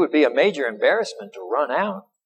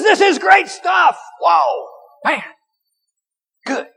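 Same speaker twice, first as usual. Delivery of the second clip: high, loud delighted shout; excited rave; 13 semitones higher, 4 dB louder, slower than usual. Retold or imitated. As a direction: rave about the wine. Steward raves about the wine.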